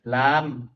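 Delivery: "lam" drawn out on the a; long vowel. Shown as a demonstration. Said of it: "lam panjang ya". ("lam" dipanjangkan)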